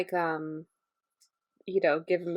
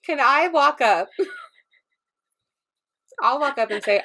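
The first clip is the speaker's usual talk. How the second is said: talking through popcorn; mouth full; 4 semitones higher than usual. Real, not acted. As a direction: say it round a mouthful. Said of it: "your mouth is full now".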